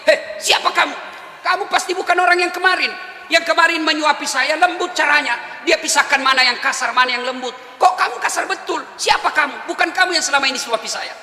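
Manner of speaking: high, sharp and accusing; suspicious old man scolding; indignant complaint. Acted. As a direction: push the stranger's hand away in anger. Blind man rejects rough feeder.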